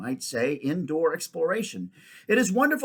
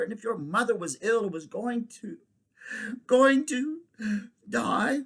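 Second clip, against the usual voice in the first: high, voice cracking on going to die; boy near tears; 5 semitones higher than usual. Acted. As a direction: choke back tears as you go. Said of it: "trying to keep back the tears".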